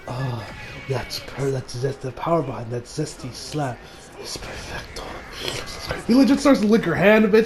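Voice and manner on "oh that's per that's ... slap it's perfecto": lower, softer, ending in a whisper; hushed relish. Retold or imitated. Creep savouring a slap from the woman, hushed whisper. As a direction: savour the slap in a breathy hush. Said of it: "he's just like".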